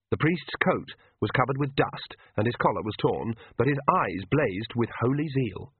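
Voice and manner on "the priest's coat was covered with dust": ordinary delivery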